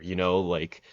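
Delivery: usual speaking voice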